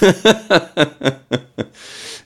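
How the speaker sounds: loud high-pitched laugh. Real, not acted. Laughing at [name] quote.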